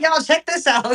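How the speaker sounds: high, loud teasing imitation; mock streamer hype. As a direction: tease him as an excited live-streamer. Co-host playfully imitates his live stream.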